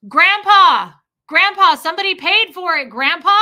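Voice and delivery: loud, high shout at a hard-of-hearing grandpa; shouting for the deaf. Retold or imitated. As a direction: holler it so he can hear. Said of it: "So I had to shout in public".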